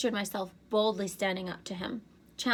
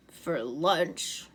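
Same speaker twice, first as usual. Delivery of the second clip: growled; 4 dB louder than usual. A narrator's reading. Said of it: "Agrias growled".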